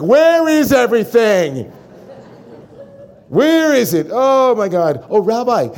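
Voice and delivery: hammed-up panic, drawn out; mock frustrated shouting at home; frantic yell at home. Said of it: "and then you go home, it's like".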